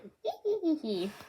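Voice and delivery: high giggling villain cackle; Doflamingo laugh. Retold or imitated. Ending the Doflamingo quote with a high cackle. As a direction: let out a high smug giggle. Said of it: "I have an amazing Doflamingo impression".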